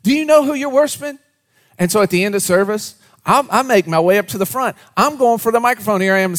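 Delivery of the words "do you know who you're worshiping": indignant outburst. Retold or imitated. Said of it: "I'm like".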